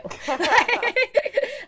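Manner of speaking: high burst of laughter; loud laugh. Real, not acted. Laugh after retort.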